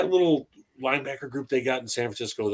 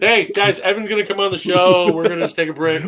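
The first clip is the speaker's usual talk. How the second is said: loud, raised sitcom announcer; mock announcement; 6 semitones higher, 9 dB louder than usual. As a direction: announce it big to the room. Joking announcement about a heckling viewer.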